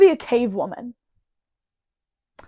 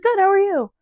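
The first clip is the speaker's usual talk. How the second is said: 'Good' said much higher, bright and chirpy; fake cheerful reply; 8 semitones higher than usual. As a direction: chirp a fake-happy answer at the gym. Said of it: "you're like".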